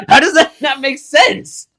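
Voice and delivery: loud, high, incredulous laughing protest. Real, not acted. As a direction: protest loudly through laughter, drawn out. Laughing mid-quarrel over the count.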